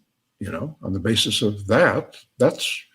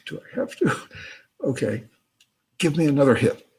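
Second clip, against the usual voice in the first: small, high mock whimper; acted reluctant whine; reluctant pleading; 5 semitones higher, faster than usual. Alvin reluctant to obey voice.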